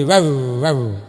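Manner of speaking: mock barking wow wow; imitated dog bark. Neighbour's dog barking at singing, mock bark.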